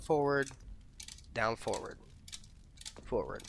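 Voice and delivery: forward, down, forward with long gaps between; separated right example. Shown as a demonstration. Player showing viewers the wanted inputs, spaced out step by step. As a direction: set each input apart with a clear pause. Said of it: "That's what we want".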